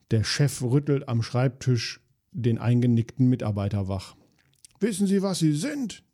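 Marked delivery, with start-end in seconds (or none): 4.8-6.0 mock roar. A narrator's reading. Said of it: "brüllt er".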